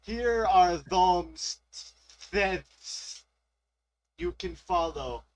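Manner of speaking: very slow dramatic read. Acted. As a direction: drag the words out. Reads AI text slowly.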